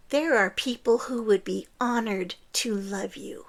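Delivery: ordinary talk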